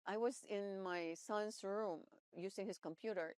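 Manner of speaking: normal talking voice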